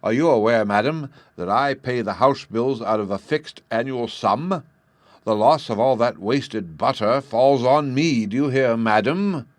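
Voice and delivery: loud, indignant scolding; angry father. A narrator's reading. Angry father scolding his wife about the butter bill, loud. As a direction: berate the wife over the wasted butter. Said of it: "the angry father".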